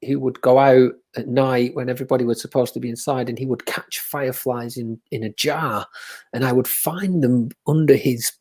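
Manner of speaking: usual speaking voice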